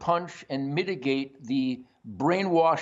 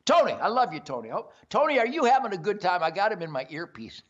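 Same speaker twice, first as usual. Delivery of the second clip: usual speaking voice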